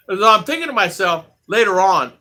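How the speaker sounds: ordinary delivery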